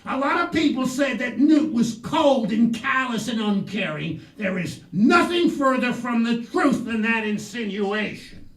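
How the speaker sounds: indignant defense. Real, not acted.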